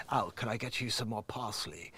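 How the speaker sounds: soft, low toothy mimicry; mock his toothy way of talking, softly; toothy stupid voice. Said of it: "his teeth are showing when he freaking speaks".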